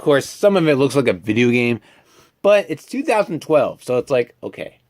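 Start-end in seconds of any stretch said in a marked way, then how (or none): none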